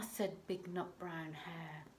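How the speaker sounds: plain reading voice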